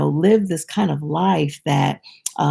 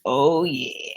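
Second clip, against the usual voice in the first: whispered smug oh yeah; hushed and pleased with themselves; a hint of gloating; 4 semitones lower, 4 dB quieter than usual.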